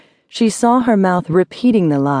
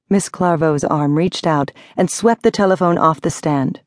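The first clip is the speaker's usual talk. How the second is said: usual speaking voice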